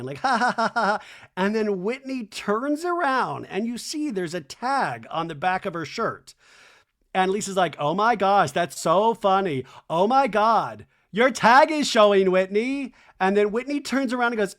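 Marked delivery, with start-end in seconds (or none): 0.3-1.0 high imitated laugh in quick pulses; mock laughing. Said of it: "Lisa Barlow at this point is laughing". 7.8-12.9 loud, rising imitation of amused delight; mock gushing. Mimicking Lisa's amused gushing.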